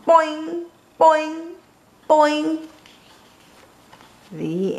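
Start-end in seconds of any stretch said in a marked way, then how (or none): 0.1-2.7 three high, ringing boings; springy boing imitation. A narrator's reading. Froggy popping wheelies all day, high springy boings. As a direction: bounce each one like a spring.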